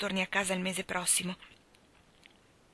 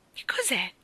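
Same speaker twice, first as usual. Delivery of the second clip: hushed, wondering whisper; Mary asks what a skipping rope is, curious and hushed; curious question. Acted. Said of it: "chiese incuriosita".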